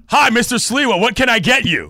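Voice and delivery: high, loud waiter greeting; hammed-up server at full volume; oblivious loud server. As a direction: boom it out with waiterly cheer. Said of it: "Let's just say I'm the server".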